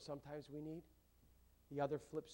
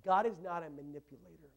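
normal talking voice

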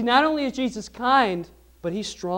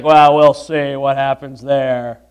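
imitated skeptical attitude; 7 semitones lower, 4 dB louder than usual. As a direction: imitate a doubter holding back judgement, pitched well down. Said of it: "what kind of attitude is that to have".

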